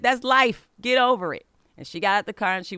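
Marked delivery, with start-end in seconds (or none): 0.0-1.5 loud, high, exasperated snap at her daughter; mother snapping back at upset daughter, loud and angry; angry retort. Retold or imitated. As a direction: tell her off sharply, raised voice. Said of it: "didn't really wanna apologize".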